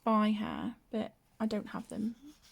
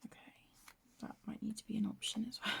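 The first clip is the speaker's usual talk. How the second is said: whispering; 9 dB quieter, much faster than usual. Real, not acted. Quick muttered note to self.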